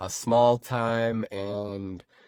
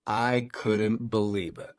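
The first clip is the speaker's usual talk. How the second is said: usual speaking voice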